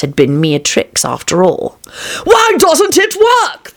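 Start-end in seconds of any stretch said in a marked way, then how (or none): 2.2-3.7 high, drawn-out royal screech; king's scream. A narrator's reading. King to the charlatan over failed magic, screaming. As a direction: scream it in exasperation, high and slow. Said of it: "the king screamed at the charlatan".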